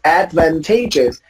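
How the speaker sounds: advantageous stressed on TA, ad-van-TAY-jus; weight on TAY in advantageous; carefully repeated ad-van-TAY-jus; shifted stress. Shown as a demonstration.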